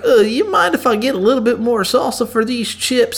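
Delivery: high, wheedling polite request; polite customer. Acted. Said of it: "you ask, like".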